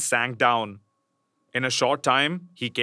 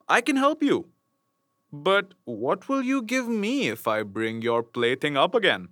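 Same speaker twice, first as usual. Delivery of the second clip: frog; 3 semitones higher than usual. Acted. Frog offering the princess help, sly frog voice. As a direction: bargain like a crafty little frog. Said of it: "answered the frog".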